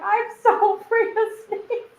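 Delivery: high, whimpering confession; half crying about the fear; tearful admission. Retold or imitated. Confessing her snake fear to the father, whimpering. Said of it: "I'm like".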